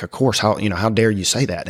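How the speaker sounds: ordinary talk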